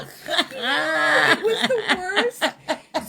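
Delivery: drawn-out laughing groan; the worst, stretched and laughing. Real, not acted. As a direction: laugh it out slowly.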